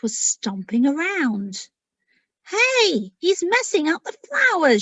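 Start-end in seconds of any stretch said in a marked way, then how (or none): 2.5-4.8 higher, louder indignant cry; angry. Acted. Little dragon angry at the giant trampling flowers, higher and louder. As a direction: call out in protest. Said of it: "she said angrily".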